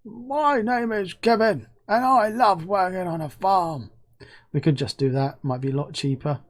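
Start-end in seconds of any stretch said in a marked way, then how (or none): 0.0-3.9 much higher, slow, cheery villager; a high sing-song game villager introducing himself; NPC voice. Acted. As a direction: play a chirpy farm NPC greeting the player. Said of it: "a bone here on the N P C to go".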